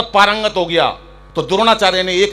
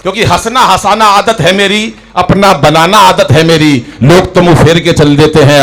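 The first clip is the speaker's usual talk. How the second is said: declaimed couplet; 11 dB louder than usual. Acted. Stage poem recited loudly.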